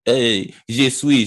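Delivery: usual speaking voice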